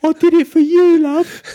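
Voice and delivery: very high, loud comic film voice; Full Monty impression. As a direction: put on a squeaky, pleading film-character voice. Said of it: "remember that film, The Full Monty".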